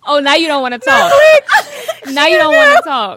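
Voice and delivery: laughing hard through the words. Real, not acted. Laughing at the hang-up.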